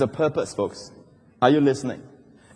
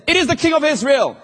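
raised, high-pitched exclamation; high, urgent alarm; urgent battle cry; 9 semitones higher than usual. Acted. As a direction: call out the sighting to fellow captains. Captains spot the king in battle.

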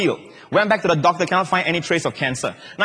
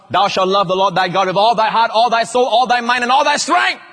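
rising higher and louder with each item; building demand; 4 semitones higher, 4 dB louder than usual. Acted. Preacher quoting the law's greatest commandment, mounting intensity. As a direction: pile up each demand, climbing to the last word.

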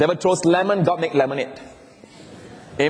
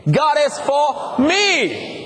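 "me" lifted much higher, slow; stress on me; 10 semitones higher, much slower than usual. Shown as a demonstration. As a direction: soar up on "me". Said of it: "and then us".

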